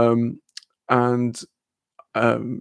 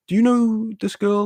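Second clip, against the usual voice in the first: high-pitched curious ask; asking a classmate about her, high and eager; eager question; 10 semitones higher than usual. Retold or imitated. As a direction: lean in, keen for gossip.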